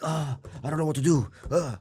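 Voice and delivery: imitating a lost, worried self; helpless worry. To the host, mimicking his old panicked self, high anxious fretting.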